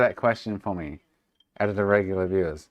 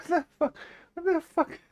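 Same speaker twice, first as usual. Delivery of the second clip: very high squeaked disbelief; incredulous squeal; faster than usual. Real, not acted. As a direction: squeal it in real amused shock. Laughing shock at dog's antics.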